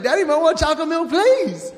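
lightly imitated boy begging; little boy pleading. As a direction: rush the request like a kid. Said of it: "He's like".

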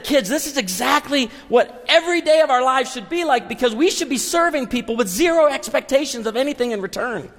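high-pitched, charged-up exhortation; fired up. Retold or imitated. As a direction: preach it excitedly to the kids. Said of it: "I'm fired up".

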